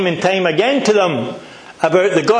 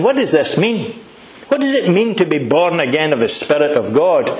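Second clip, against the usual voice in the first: usual speaking voice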